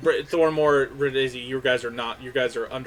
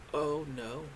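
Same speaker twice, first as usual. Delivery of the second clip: dismayed; 10 dB quieter than usual. Acted. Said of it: "you see the elf look up and go".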